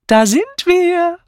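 high, loud, drawn-out announcement; cheery high proclamation; cheerful. A narrator's reading. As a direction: sing out the arrival merrily. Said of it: "verkündete sie fröhlich".

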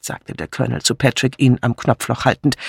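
usual speaking voice

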